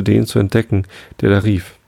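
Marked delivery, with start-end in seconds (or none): none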